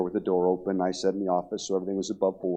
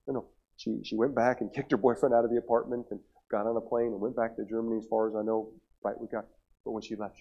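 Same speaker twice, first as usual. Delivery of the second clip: ordinary delivery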